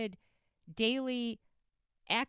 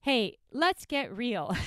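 normal talking voice

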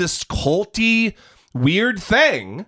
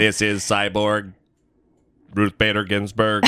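robot voice; 8 semitones lower than usual. Pretend. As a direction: intone it like a machine. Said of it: "You're gonna read it in a robot voice".

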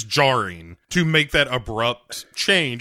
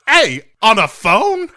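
high, loud mock-indignant villain; hammed-up outraged villain, slow and high; Zorin; 7 semitones higher, 5 dB louder than usual. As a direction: act affronted in a put-on villain voice. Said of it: "Zorin is just like".

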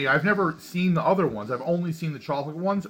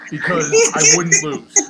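laughing; 8 dB louder, slower than usual. Real, not acted. Laughing at own stubbornness.

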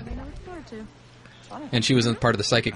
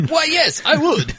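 higher, louder put-on character voice; Captain Skippy voice; 5 dB louder, slower than usual. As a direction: pitch it way up and push it out as a chirpy character. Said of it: "Captain Skippy".

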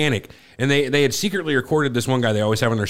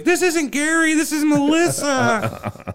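very high falsetto, drawn out; imitated fake Melissa; much slower than usual. Man hiding from the caller, shrill fake falsetto. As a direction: absurdly fake woman's voice, stretched at the end. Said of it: "he's like".

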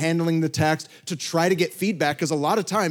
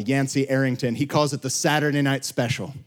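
ordinary talk, nothing marked

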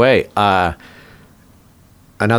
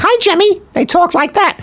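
Jersey aunts. Retold or imitated. Guest imitating his mother's sisters, high Jersey accent. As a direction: chirp it like a Jersey aunt. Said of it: "her sisters were like".

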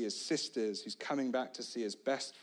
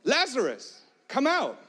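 much higher, much louder call; commanding shout; 14 semitones higher, 9 dB louder than usual. Acted. Jesus ordering Lazarus out of the grave, a commanding shout. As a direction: summon him out with full force. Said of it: "And he goes on".

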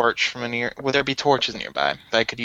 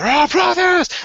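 mock yell; 5 dB louder, slower than usual. Shown as a demonstration. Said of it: "not, like, yell".

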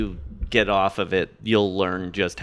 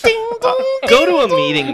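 mock sing-song; 6 dB louder than usual. Mock doorbell and advice.

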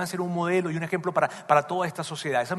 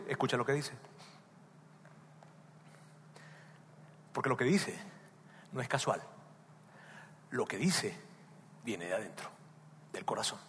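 much softer, dropping almost to a murmur; hushed and intimate; 5 semitones lower, 13 dB quieter than usual. Acted. Pastor confiding to his listeners, hushed. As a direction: draw them close with a hush.

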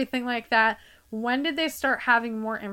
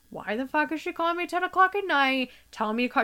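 higher, exasperated inner rant; irritated; 6 semitones higher, faster than usual. Retold or imitated. Annoyed daughter grumbles about mom's late call, higher and exasperated. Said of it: "irritated as fuck".

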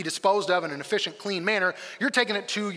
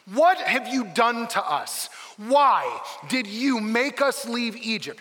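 slow, higher, exasperated accusation; sarcastic complaint; 4 semitones higher, much slower than usual. Acted. Israelites blaming Moses for leaving Egypt, sarcastic and slow. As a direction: drag out each accusing question with sarcasm. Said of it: "A lot of sarcasm".

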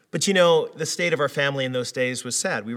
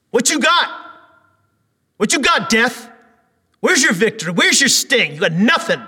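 high, loud taunting; trash talk; 10 semitones higher, 12 dB louder than usual. Acted. Paul taunting death like a player after a dunk, loud trash talk. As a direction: taunt death like you just dunked on him. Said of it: "you say when you dunk on the other guy".

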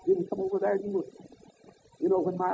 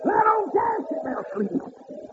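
high ringing repeat; 13 semitones higher, slower than usual. Acted. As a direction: pitch it up and ease off the volume. Repeat, higher and softer.

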